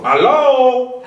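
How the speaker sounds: "Hello" called out very high and loud; call to the crowd. Shown as a demonstration. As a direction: lead the audience with a high ringing call. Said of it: "I do it three times".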